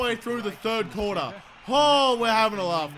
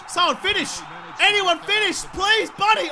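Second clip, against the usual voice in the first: high, loud urgent appeal; desperate pleading; 9 semitones higher, 5 dB louder than usual. Real, not acted. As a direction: beg teammates at the top of your voice. Begging teammates to finish the play.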